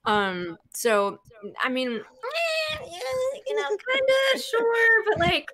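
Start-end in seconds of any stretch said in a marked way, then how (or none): 1.9-5.5 high, drawn-out waffling; doubtful hedging. Real, not acted. Guest hedging about speaking fluent Russian, high doubtful drawl. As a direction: stretch it slowly up high, unsure.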